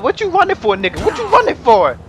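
high-pitched taunt after someone fleeing; high, goading shout-out; taunting call. Real, not acted. Chasing a fleeing game character.

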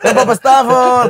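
logat Buton, pelan dan lebih keras. Retold or imitated. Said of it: "Itu, itu logat Buton". (logat Buton yang diperlambat)

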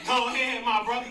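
hype-man ad-lib. Retold or imitated. Sidekick backing up the preacher, mock hype. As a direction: egg the preacher on, imitating his sidekick. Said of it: "doing ad-libs in the background".

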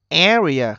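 Area with a loud, long first syllable; stress on first syllable. Shown as a demonstration. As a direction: hit the A of Area hard. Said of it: "trọng âm rơi âm thứ nhất". (nhấn mạnh, kéo dài âm đầu của Area)

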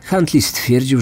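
ordinary delivery, nothing marked

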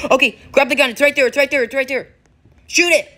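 high excited urging; urgent. Real, not acted. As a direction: push them to act. Urging teammate to shoot.

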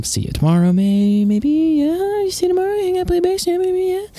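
nervous goodbye sliding up to extreme falsetto; rising squeak. Acted. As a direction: voice climbing absurdly high. Awkward goodbye climbing into a squeak.

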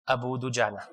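low, soft and flat, half laughing; flat clip. Retold or imitated. He recalls the two-second clip he recorded, flat and soft. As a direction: say it like a dull recorded insert. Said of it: "I heard how I said it".

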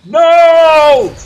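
sudden loud yelled no; shout. Real, not acted. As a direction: yell it out in frustration. Gamer yelling at game.

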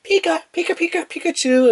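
very high squeaky cartoon voice; high squeaky critter calls; Pikachu imitation. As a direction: chirp it like a tiny pocket monster. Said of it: "where they say, like".

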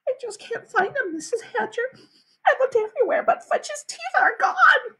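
put-on high, tearful girl's voice; sniffling. A narrator's reading. Sheila confessing to the mother, high sniffly whimper. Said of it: "Sheila sniffed and said".

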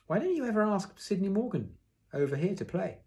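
high, lilting encouragement; cheery suggestion. A narrator's reading. Father urging son to invite a friend, cheery suggestion.

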